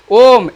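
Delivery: ओम with the O held long; sustained ओम; drawn-out call. Shown as a demonstration. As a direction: stretch ओम as a calling example. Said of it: "जहाँ भी आपका पुकारना होगा".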